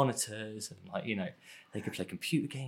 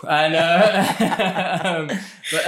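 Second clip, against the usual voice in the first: laughing; 8 semitones higher, 6 dB louder, much slower than usual. Real, not acted. Laughing at own typecasting.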